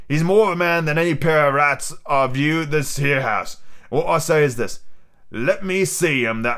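scornful pirate captain asserting command; Long John Silver, commanding. Acted. Silver rebukes the crew and shields Jim, commanding pirate. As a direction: assert your rank as an old sea rogue.

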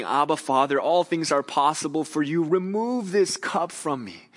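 pouring out his heart. Retold or imitated. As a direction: beg to be spared, voice lifted high. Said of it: "pouring out his heart before God".

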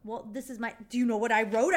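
ordinary talk, nothing marked

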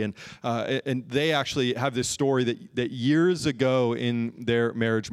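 usual speaking voice

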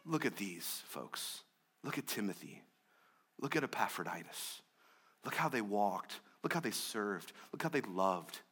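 soft, earnest pointing to examples; hushed preaching. Real, not acted. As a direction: draw them in quietly.

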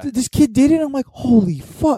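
high excited amazement; amazed. Real, not acted. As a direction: gush with amazement. Amazed retelling.

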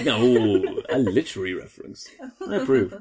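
ordinary delivery

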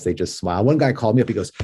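ordinary talk, nothing marked

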